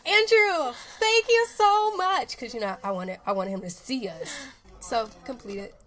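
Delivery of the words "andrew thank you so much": higher, louder, slow gushing thanks. Retold or imitated. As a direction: gush it out sweetly. Retold gushing thanks to boss.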